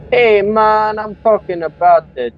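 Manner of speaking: high, loud mock Jamaican accent; put-on Jamaican accent. Redoing his Jamaican bit for his friend, Jamaican accent. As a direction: draw out the words in a joking Jamaican accent. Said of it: "no, I did more than that".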